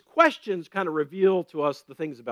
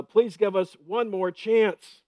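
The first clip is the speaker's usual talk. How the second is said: slow, drawn-out begging plea; slow mock plea from a student; 5 semitones higher, 4 dB louder, slower than usual. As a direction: beg for one more chance, slowly. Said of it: "teachers can really probably hear that".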